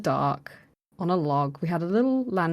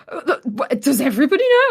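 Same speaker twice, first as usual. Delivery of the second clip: high, louder stammering alarm; wife-to-be's mock alarm, much higher; panicked question; 8 semitones higher, 5 dB louder than usual. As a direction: stammer out the dread. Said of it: "she went".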